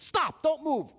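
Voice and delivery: high, loud mock-alarmed halt; urgent command. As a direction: call out to freeze someone on the spot. Retells halting the clerk urgently.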